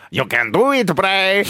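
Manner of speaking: lightly put-on default puppet voice; Huskus. Host imitates the pig puppet's encouragement, stock puppet voice. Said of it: "is the default voice".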